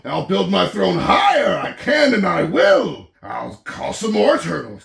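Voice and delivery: loud, determined royal rant; ranting king. Acted. Yertle ranting for more turtles.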